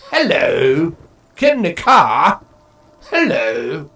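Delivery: loud, jokey imitation of a posh English accent; mock posh English. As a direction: mimic a posh Brit greeting loudly. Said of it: "I didn't sense any English posh".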